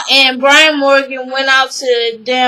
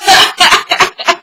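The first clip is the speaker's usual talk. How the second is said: bubbling laugh after a whoop; real laughter; 3 semitones higher than usual. Real, not acted. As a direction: crack up at your own impression.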